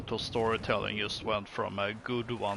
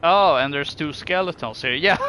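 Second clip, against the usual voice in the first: ordinary talk, nothing marked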